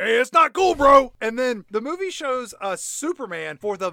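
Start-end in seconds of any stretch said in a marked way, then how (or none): 0.0-1.1 loud, high, whiny protest. Acted. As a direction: yelp it like a hurt bro. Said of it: "He's like".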